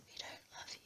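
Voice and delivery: scornful whisper, almost no voice; whispered put-down to the camera; whispered jab. Real, not acted. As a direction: hiss it quietly like a secret. Scornful aside at Perez.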